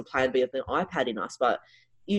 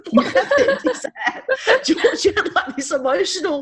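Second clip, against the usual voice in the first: laughing; 6 dB louder, slower than usual. Real, not acted. Laughing at the story's twist.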